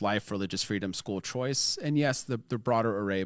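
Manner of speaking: ordinary talk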